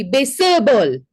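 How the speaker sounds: "baseball" as three syllables, base-uh-ball; "baseball" with a loud uh inserted in the middle; intrusive schwa. Shown as a demonstration. Showing a schwa inside a word, intrusive schwa. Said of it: "a in the middle".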